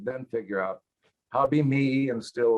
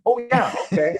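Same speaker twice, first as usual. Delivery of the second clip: eager yes; 13 semitones higher, 7 dB louder than usual. Retold or imitated. Eager yes to pilot offer.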